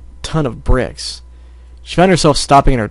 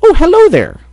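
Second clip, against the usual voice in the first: very high, loud sing-out hello; Mrs. Cake's greeting; 7 dB louder than usual. Acted. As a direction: greet a customer in a high-pitched shopkeeper voice. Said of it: "heard her voice go".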